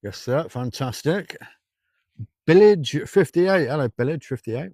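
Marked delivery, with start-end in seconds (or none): 2.5-3.7 loud, slow, drawn-out viewer name; mock announcer greeting. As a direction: boom the name out slowly to tease. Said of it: "I always do that to people".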